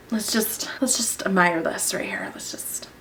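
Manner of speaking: dropping to a near whisper in awe; hushed, reverent admiration; awed hush. Real, not acted. Beauty vlogger hushing as she admires her new highlighter.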